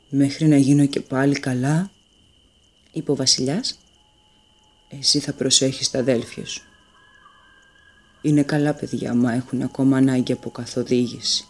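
low, tired voice of an ailing king; weak old king. A narrator's reading. The sick king entrusting his youngest son, low and weary. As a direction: speak gently, worn out by illness.

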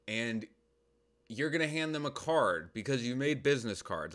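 normal talking voice

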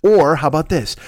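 ordinary talk, nothing marked

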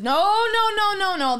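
loud, high string of protests; retold indignant refusal. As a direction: re-enact an outraged refusal, voice jumping up. Retold refusal of ninety-minute wait.

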